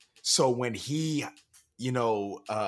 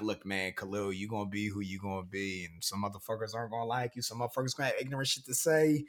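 softer, fast, talking himself down; quick hushed self-reassurance; self pep talk; 3 semitones lower, 6 dB quieter, faster than usual. Retold or imitated. To himself, a soft fast inner pep talk. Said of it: "being like".

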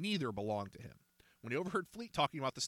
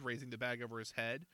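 plain reading voice

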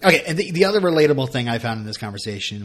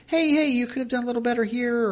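high sing-out mock scolding; pitched way up, pretend coaching voice; mock gentle critique; 12 semitones higher, faster than usual. Parent nudges a child about lazy artwork, mock critique.

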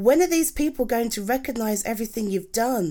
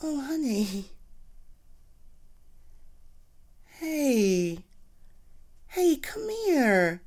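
tender comfort; 4 semitones higher, 5 dB quieter than usual. Acted. Comforting upset partner.

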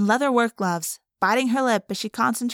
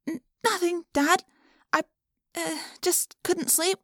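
nervous stammer; 7 semitones higher, 3 dB quieter than usual. Acted. Caught mid-morph, lying to father.

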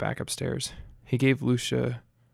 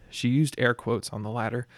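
plain reading voice

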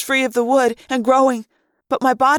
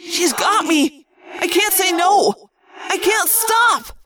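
high, drawn-out anguish; anguished cry; 7 semitones higher, slower than usual. A narrator's reading. Marco answers that the Queen has him, anguished cry. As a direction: cry out slowly, climbing higher in helplessness. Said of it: "he cried in anguished response".

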